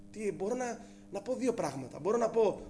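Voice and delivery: normal talking voice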